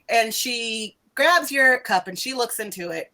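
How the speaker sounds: ordinary talk, nothing marked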